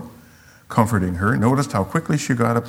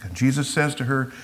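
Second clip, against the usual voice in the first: normal talking voice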